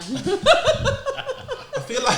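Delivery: words squeezed out through laughter; laughing. Real, not acted. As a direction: laugh while speaking. Group laughing at joke.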